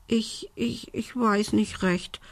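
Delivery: hesitant, small high voice; stammering. A narrator's reading. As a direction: falter softly, unsure of yourself. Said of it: "stotterte Belinda".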